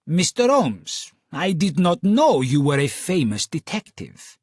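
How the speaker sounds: a light Italian contralto, lingering; Italian accent. Acted. Client to Holmes, in a lilting Italian accent. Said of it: "seductive lilt of an Italian accent".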